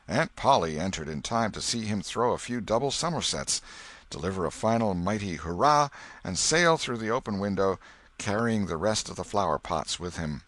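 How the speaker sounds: usual speaking voice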